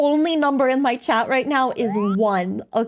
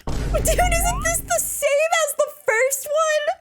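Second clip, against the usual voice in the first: cute anime streamer; 13 semitones higher than usual. Acted. Streamer mocking clip, high voice.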